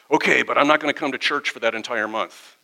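a mild huff of defiance; defiant parishioner. Retold or imitated. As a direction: push back like a sulking churchgoer. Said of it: "that's the wrong attitude".

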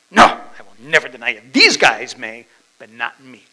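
loud, emphatic vow of loyalty; indignant Peter. Acted. Peter protests to Jesus that he'll never deny him, indignant. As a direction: protest it loudly, then ease off. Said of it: "That's Peter's heart".

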